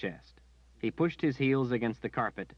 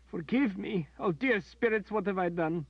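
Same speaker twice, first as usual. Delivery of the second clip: high, tearful sobbing plea; high broken sobbing; 12 semitones higher, 3 dB louder than usual. A narrator's reading. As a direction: beg forgiveness through sobs. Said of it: "she sobbed".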